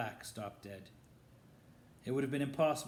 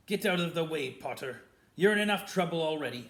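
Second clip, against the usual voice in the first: louder, biting snarl; 4 semitones higher, 10 dB louder, faster than usual. A narrator's reading. Snape ordering Harry out of the doorway, loud snarl. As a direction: spit the order at him. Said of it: "snarled Snape".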